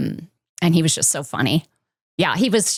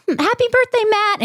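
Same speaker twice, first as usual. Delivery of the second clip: much higher, sing-song birthday wish; cheery birthday greeting. Retold or imitated. Mom greets her birthday boy in hospital, high and cheery. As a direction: greet a little one in a high, doting lilt. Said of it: "and I was like".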